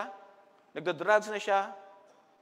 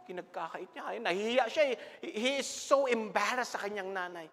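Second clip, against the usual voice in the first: normal talking voice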